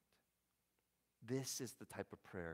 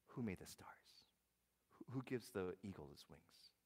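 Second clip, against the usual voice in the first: whispered awe; 6 dB quieter than usual. Retold or imitated. Pastor voicing God's question to Job, whispered. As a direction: breathe the question softly.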